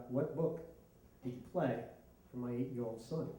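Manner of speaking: low, soft, measured questioning; restrained mock-serious dad voice; stern father. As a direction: confront him quietly, word by word. Stern father confronts the narrator.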